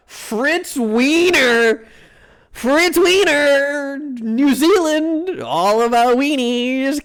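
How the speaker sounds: high, drawn-out announcer cry; hammed-up announcing. Streamer announcing a New Zealand dish, in hammed-up hype. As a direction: call it out high, stretching every word.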